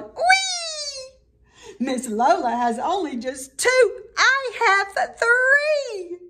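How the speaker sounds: high squealing whee, then a slow, high I have three; gleeful yell. A narrator's reading. Gertrude gloats over Lola, high gleeful squeal. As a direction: crow it out, giddy and triumphant. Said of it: "And then Gertrude yelled".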